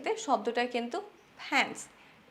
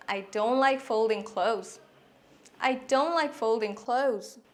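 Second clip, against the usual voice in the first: slow model sentence; much slower than usual. Shown as a demonstration. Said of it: "যেমন".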